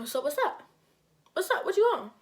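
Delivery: high, provoking call-out; challenging. Retold or imitated. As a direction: act out daring someone to fight. Acted challenge to fight.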